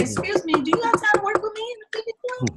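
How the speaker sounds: high, fast, fawning voice; playing a fawning producer; mock polite request. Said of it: "these people will be like".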